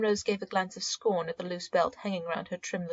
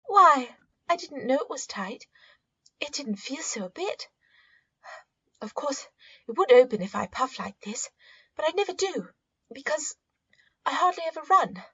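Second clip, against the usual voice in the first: put-on higher, lighter girl's voice, a little flustered; higher, girlish voice, insisting she never puffs; young girl Rose; 7 semitones higher, 4 dB louder than usual. A narrator's reading. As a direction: play the embarrassed young niece protesting.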